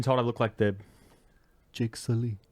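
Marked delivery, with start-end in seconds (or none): 1.7-2.4 lightly put-on film hero voice; imitated film character. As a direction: say the name as if imitating him. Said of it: "Is that how he talks?".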